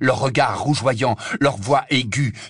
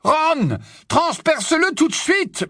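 high urgent yell to his friend; frantic high shout; desperate bellow; slower than usual. Acted. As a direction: bellow it, pleading. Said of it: "beugla Harry".

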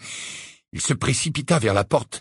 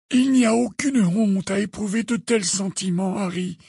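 calm; 10 semitones higher than usual. A narrator's reading. Dumbledore consoling Harry over his feelings, calm. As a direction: reassure gently in an aged, lifted voice. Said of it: "de la même voix calme".

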